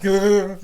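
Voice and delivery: short-circuit noise. Acted. Imitating a short-circuited person, sudden high malfunction noise. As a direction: blurt out a glitching brain-chip noise. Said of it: "that short circuit and you're just like".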